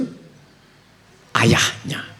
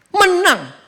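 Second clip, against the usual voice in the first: excited exclaim; 13 semitones higher, 4 dB louder than usual. Real, not acted. Exclaims victory.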